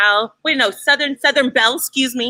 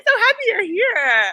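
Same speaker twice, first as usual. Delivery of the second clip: excited; 12 semitones higher than usual. Real, not acted. Gushing slow high.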